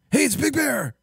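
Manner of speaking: high, out-of-breath Big Bear voice; Big Bear impression. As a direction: run out of breath on every word. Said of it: "You have to run out of breath during every sentence".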